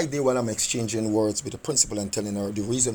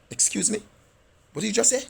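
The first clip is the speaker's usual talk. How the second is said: retold disbelief; 9 semitones higher, faster than usual. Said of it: "I'm like".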